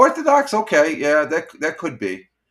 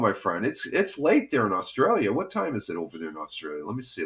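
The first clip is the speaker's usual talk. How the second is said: ordinary talk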